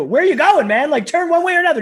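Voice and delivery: high, loud, exasperated yell at the driver; mock road-rage yell. Passenger yelling at a driver drifting toward the exit, loud and exasperated. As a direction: bellow it like he's missing the exit.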